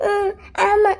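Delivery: small tearful admission. Acted. Reluctant bride admits to groom she doesn't want the wedding, tearful. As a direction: answer haltingly, close to tears. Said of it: "ஏம்மா அழுதுட்டு இருக்க".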